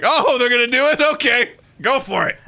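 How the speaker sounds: high-pitched mock surprise, then consent; imitated surprised dad. Dad seeing they will actually do it, imitated surprise.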